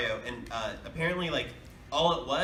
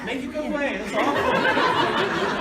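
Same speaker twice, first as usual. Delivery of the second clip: laughing; 11 semitones higher, 9 dB louder, slower than usual. Real, not acted. Reacting to bad movie.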